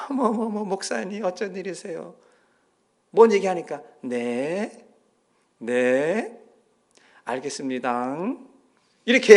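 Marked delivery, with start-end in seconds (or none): 0.1-2.1 higher, sugary surprised greeting; sweet phone voice. Retold or imitated. Mother answers the pastor's call, sugary and high. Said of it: "갑자기 톤이 바뀌는 거예요". 4.0-8.2 humbly agree, half whispering; meek yes. Retold or imitated. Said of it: "갑자기 톤이 바뀌는 거예요".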